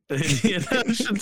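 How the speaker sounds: laughing through Indian Ocean. Real, not acted. Cracking up at his cohost's joke name, laughing. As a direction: laugh it out, amused.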